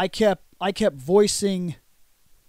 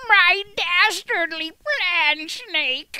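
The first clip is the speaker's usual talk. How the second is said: high, slow hammed-up villain line; cartoon villain voice; 8 semitones higher, much slower than usual. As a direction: gloat it like a cartoon bad guy. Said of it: "I was like".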